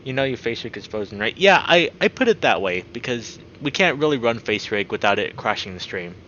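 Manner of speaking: usual speaking voice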